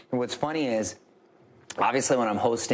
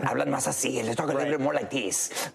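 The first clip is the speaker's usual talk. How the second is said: put-on Spanish accent; 2 semitones higher than usual. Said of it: "like Antonio Banderas' Puss in Boots character".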